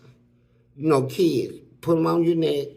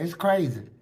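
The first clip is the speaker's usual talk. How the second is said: ordinary talk, nothing marked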